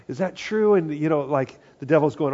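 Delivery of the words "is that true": startled question. Retold or imitated. Acting a startled listener.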